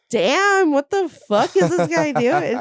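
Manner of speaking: shocked reaction. Retold or imitated. Quoting viewer's shocked reaction.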